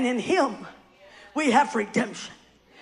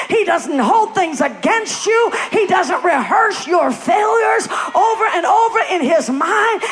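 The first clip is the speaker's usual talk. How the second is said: rising, ever louder preaching climax; full-force preaching; 3 semitones higher, 7 dB louder than usual. Acted. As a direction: build it up into a roaring proclamation.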